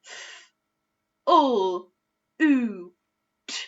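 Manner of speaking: F, ul, oo, t said as separate sounds; sounding out. Shown as a demonstration. Teacher sounding out flute again. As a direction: segment F, ul, oo, t one at a time. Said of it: "Let's do that one more time together".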